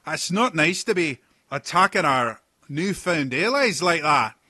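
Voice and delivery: loud, high, slow hearty sea-dog; rough pirate captain. Acted. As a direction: boom out like a jovial old pirate. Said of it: "another voice".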